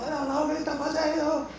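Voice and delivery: high, put-on voice of a colleague; a light mimicry of an alarmed colleague. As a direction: lift the voice to mimic the other guy. Quoting colleague, voice shifted.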